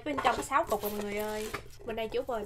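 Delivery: normal talking voice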